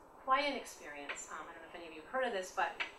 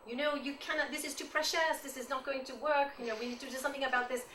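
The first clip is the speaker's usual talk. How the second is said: put-on higher, louder critique in a French accent; French-accented teacher; 5 semitones higher, 4 dB louder than usual. French teacher criticizing her students' paintings, imitated in a French accent. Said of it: "in her French accent".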